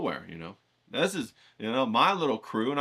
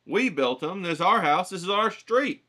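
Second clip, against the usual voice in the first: building up higher and louder, each claim pushier; indignant kid comeback; 6 semitones higher, 5 dB louder than usual. Retold or imitated. Kid narrator to the older boys, building to a higher indignant yell. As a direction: stake out your turf, voice climbing higher with each claim. Kid defending his yard jumps.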